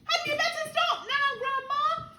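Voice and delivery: high-pitched worried question; anxious plea. A narrator's reading. George begging Grandma to stop growing, anxious plea. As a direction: urge her nervously to stop.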